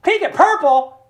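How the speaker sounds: high, loud mock objection; imitated brother's protest. Child scolding his younger brother.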